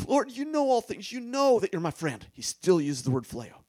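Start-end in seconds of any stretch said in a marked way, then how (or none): 0.1-2.3 higher and louder, pleading to be believed; Peter's earnest answer. Retold or imitated. Peter answering Jesus, a little higher and louder in earnest protest. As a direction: Peter protesting his friendship. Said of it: "And he said".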